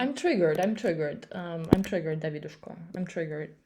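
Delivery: loud, insistent interruption; real triggered outburst. Real, not acted. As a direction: cut in loudly, repeating the protest. Listener interrupts, declaring they are triggered.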